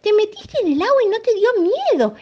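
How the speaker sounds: high, excited monkey thanks; grateful monkey. Acted. Monkey thanking the hippo, high and excited. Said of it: "El monito le agradeció".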